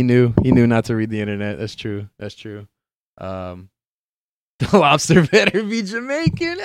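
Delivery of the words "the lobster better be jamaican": high, laughing punchline; laughing joke. Real, not acted. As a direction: laugh through the words. Joking about the mermaid casting.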